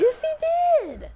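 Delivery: high, slow gloating yell; triumphant shout. Real, not acted. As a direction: crow over the touchdown. Gamer gloats over touchdown.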